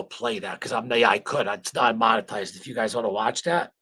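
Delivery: ordinary talk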